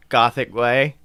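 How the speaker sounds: ordinary talk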